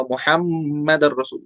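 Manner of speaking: मुहम्मदर रसूल drawn out slowly; slow recitation. Shown as a demonstration. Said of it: "वहां पे भी गुन्ना होगा". (धीमी अज़ान जैसी तिलावत)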